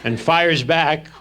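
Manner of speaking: usual speaking voice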